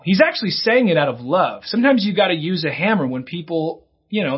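usual speaking voice

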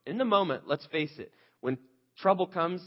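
usual speaking voice

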